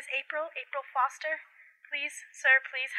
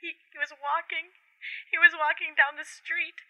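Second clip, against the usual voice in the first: ordinary talk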